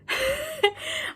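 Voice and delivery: high-pitched burst of laughter; gleeful high cackle; delighted laugh. Real, not acted. Laughs at finding sender.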